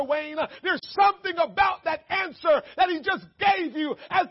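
loud, high preacher's cry; full-force preaching. Acted. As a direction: preach it out to the congregation.

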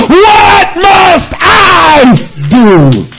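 very slow, drawn-out shouted plea; pleading cry to God. Retold or imitated. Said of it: "what you have to say to God is".

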